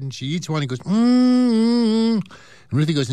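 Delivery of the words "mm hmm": loud, high, savouring mm-hmm; pleased hum. Acted. Grandma tasting a chocolate, loud high pleased mm-hmm. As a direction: hum with delight over a chocolate.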